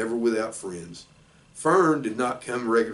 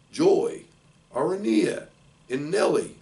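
formal address; 6 semitones higher, 3 dB louder than usual. A narrator's reading. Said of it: "make a short speech".

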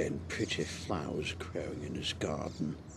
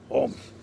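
high, loud humph; grumpy grunt; 5 dB louder than usual. A narrator's reading. Mister Grumpy is called bad-tempered, grunting indignantly. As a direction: huff it out crossly. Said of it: "grunted Mister Grumpy".

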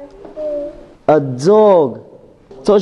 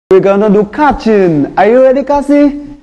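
normal talking voice

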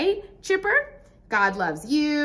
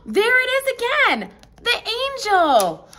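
host spotting the angel picture; excited gasp; 5 semitones higher, 4 dB louder than usual. Acted. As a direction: gasp then louder higher discovery.

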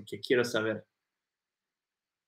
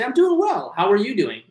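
much higher, louder friendly answer; cheery reply; 6 semitones higher, 4 dB louder than usual. Retold or imitated. Imagined friend answering a greeting, high and cheery. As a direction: beam the reply back. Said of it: "You're gonna say".

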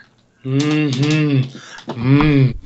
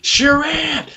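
much higher excited call of a name; 12 semitones higher than usual. Retold or imitated. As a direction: call out the name like a thrilled fan. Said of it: "a person was like".

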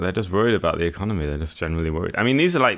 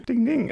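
ding sound. Pretend. Correct-answer bell in quiz game.

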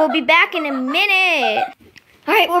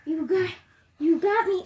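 usual speaking voice

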